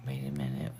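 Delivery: whispered to herself; hushed aside, barely voiced. Real, not acted. Checking phone, mutters to self.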